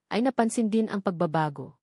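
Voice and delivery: ordinary delivery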